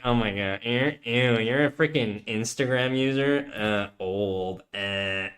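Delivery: put-on girlfriend voice. Girlfriend sneering at an Instagram user, mocking imitation. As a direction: mimic a disgusted girlfriend, quiet and droning. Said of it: "It was so, what voice?".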